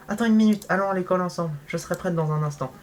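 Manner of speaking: calling out. Acted. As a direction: shout after him to wait. Reading Hiroa calling after him.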